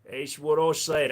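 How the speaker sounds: ordinary talk, nothing marked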